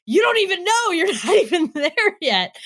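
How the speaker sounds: high, squealed protest; re-enacted incredulous outburst, pitch way up; mock exasperation. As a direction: squeal it at someone who has no idea, laughing partway through. Retold exasperated reply to clueless reviewers.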